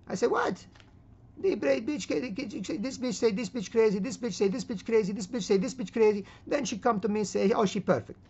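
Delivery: put-on high-pitched, accented babble; exasperated old man. Old man mocks wife's gossip about her sister, hammed-up exasperation. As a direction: squawk in disbelief as a comic old husband.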